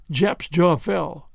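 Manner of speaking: ordinary delivery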